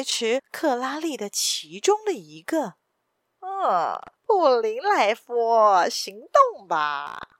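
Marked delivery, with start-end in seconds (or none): 3.4-7.3 slow, drawn-out villain's command; sneering ringleader. A narrator's reading. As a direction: stretch the words out with a cold sneer. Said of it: "那领头的人冷笑道". (冷笑着拖长声音下令)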